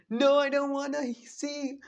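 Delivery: very high-pitched mock panic; panicked protest. Kakashi refusing to read Naruto's lips, panicked. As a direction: imitate a frantic, squealed refusal.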